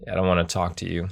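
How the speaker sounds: usual speaking voice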